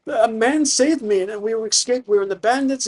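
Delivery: high-pitched, mock-tearful young man; acted distressed stammer, pitched well up; crying son. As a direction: plead it out high, as if near tears. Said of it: "And he's crying".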